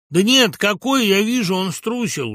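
loud, high, emphatic verdict; blunt truth-telling. A narrator's reading. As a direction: state it bluntly and forcefully. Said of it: "рубил правду Абрамов". (рубить правду, громко)

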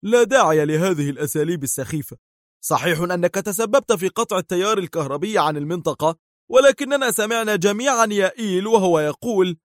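high, agitated villain voice; impatient, strained rebuttal; heated retort. A narrator's reading. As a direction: argue back insistently, climbing in pitch. Villain argues back in quarrel.

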